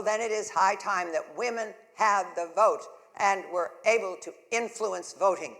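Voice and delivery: loud, fired-up stump speech; rousing campaign speech. Acted. Candidate rallying voters with a loud speech. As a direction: thunder it at the crowd like a campaign rally.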